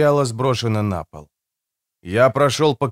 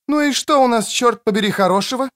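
high-pitched woman's voice; inquisitive woman; 11 semitones higher than usual. Acted. Woman joining the pair demands news, high-pitched. Креция arrives and questions them.

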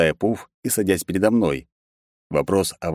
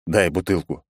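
ordinary delivery

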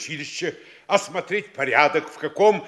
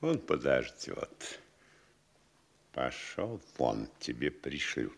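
very low, quiet and discreet; hushed intervention; 13 semitones lower, 10 dB quieter than usual. Acted. Mayor settling the bill and dismissing the servant, hushed. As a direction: step in smoothly, keeping it right down.

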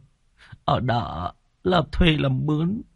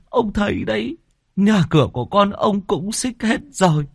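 higher, tearful pleading; whimpering plea; 10 semitones higher than usual. Acted. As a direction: beg the landlord near tears. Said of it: "Anh Khoai mếu máo". (mếu máo van xin)

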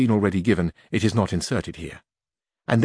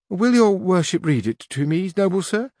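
Teresa; 9 semitones higher than usual. A narrator's reading. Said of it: "said Teresa".

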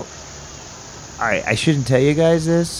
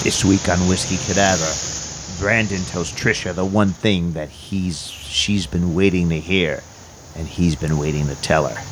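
low dramatic promo voice; deep trailer narrator read; TV promo announcer; 6 semitones lower than usual. Acted. TV promo on radio.

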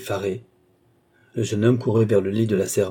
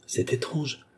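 soft, higher, uneasy murmur; heart-pounding unease; 5 semitones higher, 7 dB quieter, slower than usual. A narrator's reading. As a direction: voice it softly with a beating heart. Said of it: "avec un battement de cœur". (inquiétude, à voix plus basse et plus aiguë)

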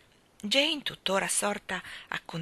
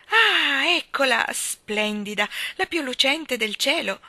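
high, loud cry of wonder; delighted awe; 6 semitones higher, 8 dB louder, slower than usual. A narrator's reading. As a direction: marvel aloud on spotting it. Spots the star, marvelling.

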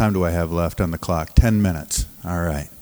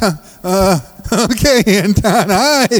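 high, laughing, unsure reply; flustered pastor; 11 semitones higher, 4 dB louder than usual. Retold or imitated. Pastor answering the drunk man from the crates, flustered and laughing. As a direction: awkwardly greet the shouting drunk. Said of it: "I'm like".

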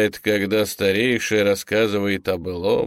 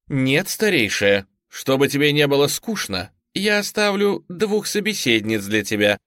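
mocking; 5 semitones higher than usual. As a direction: taunt her with false kindness. Granddaughter mocks the elder.

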